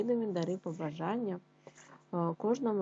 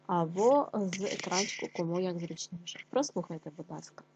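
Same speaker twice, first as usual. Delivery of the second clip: usual speaking voice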